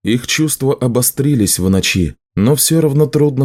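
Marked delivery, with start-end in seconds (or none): none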